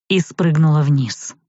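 plain reading voice

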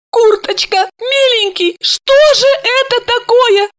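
put-on high drunk sobbing woman; drunk crying. A narrator's reading. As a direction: wail it tipsy and tearful. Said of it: "пьяно рыдала Ася".

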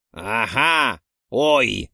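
mocking mimicry. A narrator's reading. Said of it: "передразнила её верховная ведьма".

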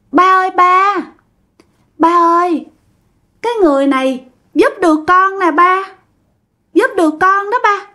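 put-on high, mincing girlish voice. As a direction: play a possessed man sounding like a dainty girl. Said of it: "theo kiểu điệu vậy nè". (giọng yểu điệu như con gái)

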